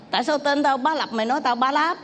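high, offended retort over a mangled name; a man bristling that his name was twisted; indignant man. Acted.

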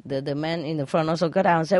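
ordinary talk, nothing marked

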